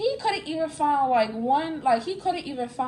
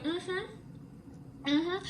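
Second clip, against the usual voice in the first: soft, suspicious mm-hmm; skeptical mm-hmm; 5 semitones higher, 9 dB quieter than usual. Retold or imitated. Imitating the boyfriend scrolling her phone, softly doubtful. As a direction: hum it like you're unconvinced. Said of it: "he was like".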